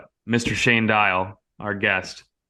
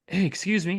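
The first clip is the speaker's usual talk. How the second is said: polite customer; 9 semitones higher than usual. Retold or imitated. Guest politely calls the employee.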